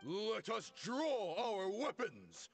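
slow, high battle cry to the troops; rallying cry. Acted. Commander rallying his followers, slow and high. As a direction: rouse the men to draw steel.

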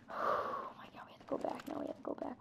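hushed, voiceless urgency; anxious whisper. Real, not acted. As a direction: whisper nervously that you must retreat. Scared at a dead end.

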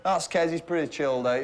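normal talking voice

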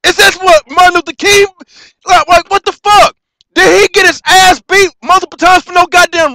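real rage, yelled high and loud; shouting in fury, close to breaking into tears; furious shout. Real, not acted. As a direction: blast it out at your own people. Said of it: "I'm furious".

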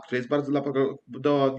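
ordinary talk, nothing marked

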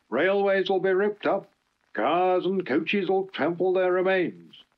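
fierce ranting rallying cry. Acted. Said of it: "he went on fiercely".

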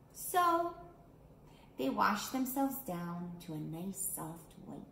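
slow, gentle storybook reading with long pauses; reading to children. A narrator's reading. Storyteller reading a picture book to keiki, unhurried. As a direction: read it out for little ones at home.

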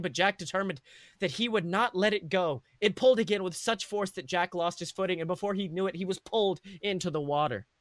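ordinary delivery

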